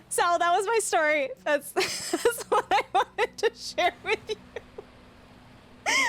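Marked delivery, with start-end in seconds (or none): 1.4-6.0 high, slow, broken up by giggles; laughing while talking. Real, not acted. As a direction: push the sentence out through laughter. Cracks up ending her story.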